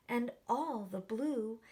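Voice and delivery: plain reading voice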